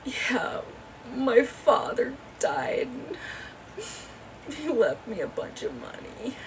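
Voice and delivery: high, slow, weepy mock sob story; mock tearful. Rival claims her father died, in high fake tears. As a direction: play the fake tearful victim. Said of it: "And she's like".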